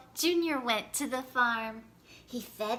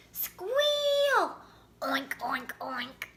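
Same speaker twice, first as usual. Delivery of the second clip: pig squeal and oinks; 2 semitones higher, much slower than usual. Acted. Pigs running to the slop bucket, squealing and oinking. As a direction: imitate hungry pigs.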